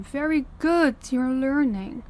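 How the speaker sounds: high, loud, drawn-out praise; condescending praise. Acted. Mistress praises obedient pet.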